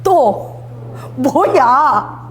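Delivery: "또 뭐야?" stretched very slowly with a sigh-like weight; exasperated demo. Shown as a demonstration. Preacher demonstrating God's reply for the congregation, very slow weary drawl. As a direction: show the congregation how a fed-up father says it. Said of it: "딱 해보세요".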